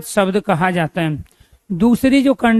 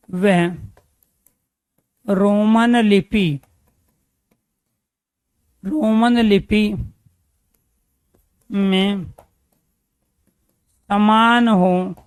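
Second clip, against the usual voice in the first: very slow, word by word, for note-taking; dictating at half speed; slow dictation; much slower than usual. Shown as a demonstration. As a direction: spell it out slowly so students can write. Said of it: "teacher dictates slowly".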